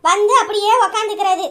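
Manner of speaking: loud, biting mother-in-law taunts; sarcastic scolding. Acted. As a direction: scold the freeloading in-law, mocking.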